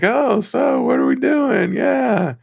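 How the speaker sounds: Bob's line. Retold or imitated. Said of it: "and Bob's like".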